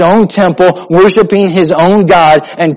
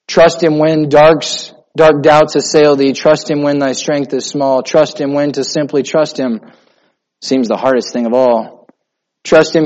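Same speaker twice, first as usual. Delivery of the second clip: recited hymn; 3 semitones lower than usual. Acted.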